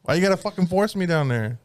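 high, indignant complaint; indignant protest. Retold or imitated. Protesting to the barber forcing his head down, high and indignant. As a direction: push back, offended and high.

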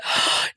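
sharp mock intake of breath; shocked gasp. Comedian realising the blunder at home, retold shocked gasp. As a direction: gasp as the penny drops.

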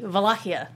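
Wallachia said with a V, vuh-LAH-kee-uh; Wallachia, V in place of W; Romanian V pronunciation. Shown as a demonstration. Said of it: "it's a V".

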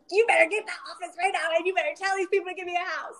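fast, firm order to the husband; bossy command. Retold or imitated. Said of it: "And I was like".

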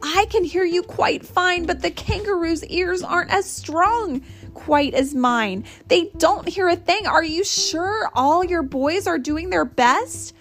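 high-pitched, urgent elephant; calling back. A narrator's reading. Horton presses the mayor about anyone shirking, calling back. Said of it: "Horton called back".

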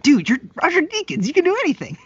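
high, loud mock exclamation; incredulous hype. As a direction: gush in exaggerated disbelief. Acted reply to humble Deakins.

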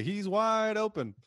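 high, drawn-out mock commentator call; imitated sportscaster cry; Niederman. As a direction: stretch it out like an excited play-by-play man. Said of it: "Niederman goes on to say".

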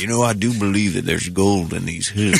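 lightly voiced old gold miner; old prospector. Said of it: "He's an old prospector".

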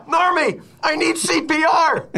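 high, slow gasping plea; hammed-up choking cry for help; dying man voice. Pretends to choke, begs Norman.

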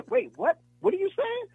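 confused. Retold or imitated.

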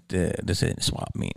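breathy half-whisper, low and soft, in a retold brush-off; hushed retort. To the autograph hunter at the airport, a hushed brush-off. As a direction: mutter it under your breath to the pushy fan. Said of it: "I was like".

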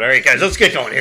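loud barking commands with a laugh; drill sergeant. Pretend. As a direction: bark orders at the troops. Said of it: "kinda what I sound like".

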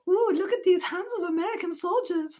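high, put-on bad French accent; terrible French accent. French women fawning over soldiers, bad French accent. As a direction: swoon in a cheesy French accent. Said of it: "it's a terrible French accent".

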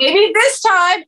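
a mock-hopeful fan plea. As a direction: wish it out loud. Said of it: "'Cause you're like".

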